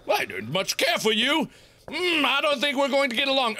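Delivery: hint of a stuffy British accent; lightly put-on British voice; mock British. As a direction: play a disapproving British settler. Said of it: "we were British at this time".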